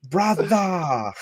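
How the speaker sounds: high, stretched Australian call; hammed-up Australian accent, pitch leaping up; mock Australian accent. Streamer repeats the Australian greeting gag, mock Australian accent. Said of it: "the Australians?".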